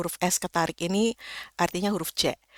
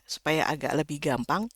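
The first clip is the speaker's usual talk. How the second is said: ordinary talk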